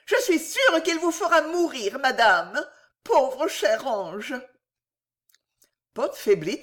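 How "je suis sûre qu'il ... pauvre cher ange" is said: high, indignant maid, slowing into pity at the end; scold, then coo over the victim; reproachful. A narrator's reading. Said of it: "dit la jeune fille d'un ton de reproche". (reproche aigu qui finit en apitoiement)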